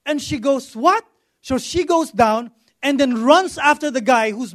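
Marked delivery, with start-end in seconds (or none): 0.7-1.0 surprised what. Retold or imitated. Lady learns wallet stolen.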